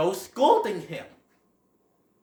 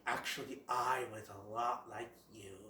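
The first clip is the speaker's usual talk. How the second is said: lower, much softer fatherly voice; Great Prince; 8 semitones lower, 15 dB quieter than usual. Retold or imitated. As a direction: speak low and hushed like a wise old stag. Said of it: "the Great Prince is all like".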